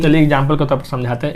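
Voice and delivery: usual speaking voice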